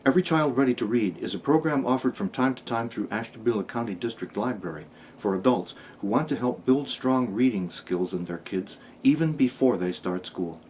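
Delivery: normal talking voice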